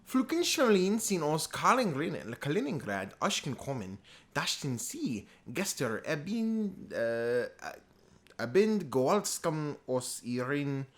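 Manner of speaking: loud, high, halting bad German; attempted German. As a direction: struggle through German you don't speak, stretching words. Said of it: "I don't speak German, but I'm trying".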